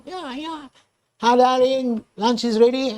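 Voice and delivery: high sing-song lady's voice; lady calling. Acted. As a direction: call your darling to lunch. Lady calls the man to lunch.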